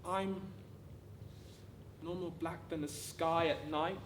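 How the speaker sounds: ordinary talk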